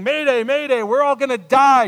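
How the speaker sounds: loud, high mock distress call; panicking co-pilot. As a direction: cry out a hammed-up mayday. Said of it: "I'm picturing the co-pilot saying".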